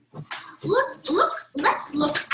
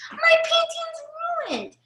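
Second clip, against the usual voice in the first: put-on upset child voice; 14 semitones higher than usual. Child's painting got spilled.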